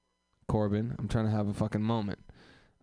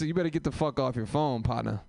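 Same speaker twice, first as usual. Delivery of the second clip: ordinary delivery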